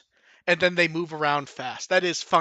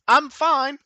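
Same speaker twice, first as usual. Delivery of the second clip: high squeaky voice; 8 semitones higher, 3 dB louder than usual. Retold or imitated. Character squeaks after cannonball.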